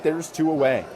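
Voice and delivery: ordinary delivery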